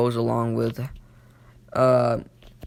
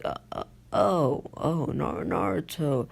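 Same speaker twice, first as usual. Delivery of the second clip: higher, softer halting stammer; nervous stutter; 4 semitones higher, 3 dB quieter than usual. Retold or imitated. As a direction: imitate someone stumbling nervously over the words. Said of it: "You know, stuttering".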